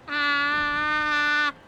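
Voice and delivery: ears covered, muffled voice. Real, not acted. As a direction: test sound with ears half covered. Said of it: "I sound muffled".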